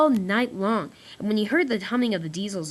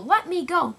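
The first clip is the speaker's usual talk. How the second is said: high pleading cry; 8 semitones higher, 3 dB louder than usual. Acted. Diesel crying out, dangling from the crane. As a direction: beg again to be let go. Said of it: "cried Diesel".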